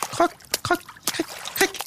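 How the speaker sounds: very high, breathless crunch sounds; tiny nibbling noises. Acted. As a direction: squeak out the bites like little fish. Fish nibbling the giant.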